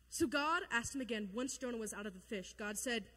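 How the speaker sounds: ordinary talk, nothing marked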